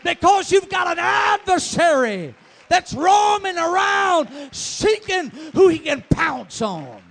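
loud, high revival-preacher shout; urgent fire-and-brimstone exhortation; full-force preaching. Real, not acted.

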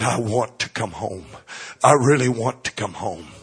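quietly longing through tears; tearful. Retold or imitated. Brenda answering her pastor from the rehab bed, tearful and soft. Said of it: "she was crying and praying and sobbing".

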